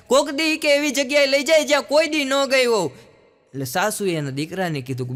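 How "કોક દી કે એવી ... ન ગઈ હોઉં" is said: bride's wish. Retold or imitated. Bride repeating her wish.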